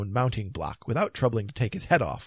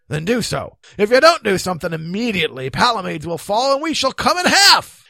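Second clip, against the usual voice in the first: loud, heated, hurried orders; building impatient shout; urgent command; 9 semitones higher, 12 dB louder than usual. Acted. Knight demanding action before Palomides falls, urgent.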